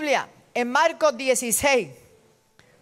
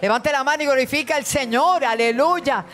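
fiery preaching; 5 semitones higher than usual. Acted. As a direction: rebuke it from the pulpit. Preaching at full force.

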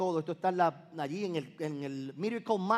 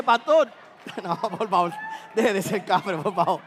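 hammed-up laughing protest; laughing mock plea; 6 dB louder than usual. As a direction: play a congregant laughing and begging the pastor. Imitating congregant's laughing plea.

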